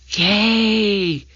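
high, drawn-out little cheer; mock-whispered yay, still voiced. A narrator's reading. Annie cheers at going to the treehouse, whispered cheer. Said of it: "whispered Annie".

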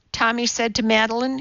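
ordinary delivery, nothing marked

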